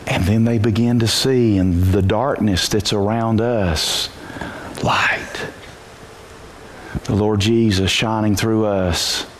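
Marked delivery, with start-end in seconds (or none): 4.8-5.5 hushed whisper on one word. Acted. Preacher to church, a hushed whispered word. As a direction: breathe the word like a revelation.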